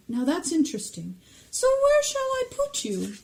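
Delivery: very high, squeaky pondering Sorting Hat; weird high hat voice. Acted. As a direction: muse aloud in a swooping, high-pitched character voice. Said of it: "that kind of weird high voice".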